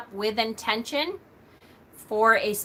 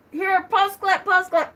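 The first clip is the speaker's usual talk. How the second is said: laughing through coughs; 9 semitones higher, 3 dB louder than usual. Retold or imitated. Coughing while handing over clip.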